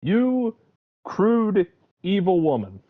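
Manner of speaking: high, drawn-out scolding, stepping down in pitch; mock indignation. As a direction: denounce the villainess with theatrical outrage. Acted scolding of film villainess.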